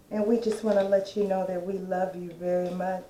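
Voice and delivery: normal talking voice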